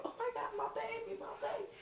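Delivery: high, fast, breathy mock panic cry; imitating his girlfriend gasping over him; panicked girlfriend. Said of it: "she instantly goes over there and go".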